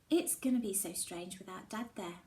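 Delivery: plain reading voice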